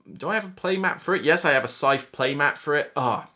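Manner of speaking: usual speaking voice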